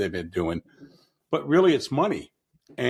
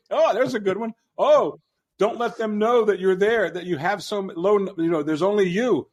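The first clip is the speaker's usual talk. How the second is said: high, fast-excited retold gushing; pitched-up imitation of a gushing admirer; quoting a guy; 8 semitones higher, 4 dB louder than usual. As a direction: gush like a fan of bad war wisdom. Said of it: "a guy said to me one time".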